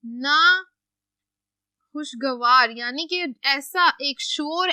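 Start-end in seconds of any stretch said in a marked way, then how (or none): 0.0-2.7 drawn out very slowly as if writing it; slow dictation. Shown as a demonstration. Teacher dictating a word to students, very slow. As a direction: stretch each syllable of नाखुशगवार slowly for the board. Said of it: "एक और लफ्ज मैं इसका लिख देती हूँ".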